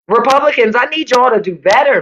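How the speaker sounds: ordinary talk, nothing marked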